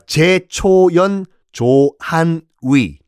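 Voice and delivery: drawn-out, syllable by syllable; slow recital. Shown as a demonstration. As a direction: spell out each name slowly, like a mnemonic. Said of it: "그때 이렇게 외우라고 말씀드렸잖아요".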